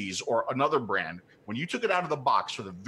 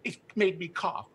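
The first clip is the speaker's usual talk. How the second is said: higher, whiny put-on voice; parodying people who quit vaping with a higher whine; mocking whine; 6 semitones higher than usual. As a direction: sneer at the complainers. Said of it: "when people say".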